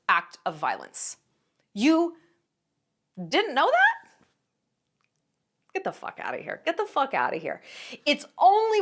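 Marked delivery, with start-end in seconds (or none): 1.7-4.1 can't believe they didn't know; sharp incredulous question. Real, not acted. Incredulous challenge. 5.7-6.6 unvoiced whisper of disbelief; whispered get the fuck outta here; whispered dismissal. Real, not acted.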